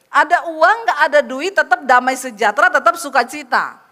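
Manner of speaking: loud, punched preaching phrases; emphatic repetition. Real, not acted. Preacher drilling a doubting congregation on joy without money, loud and emphatic. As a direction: drum it in phrase by phrase, loudly. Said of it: "Memang harus diulang-ulang begitu terus".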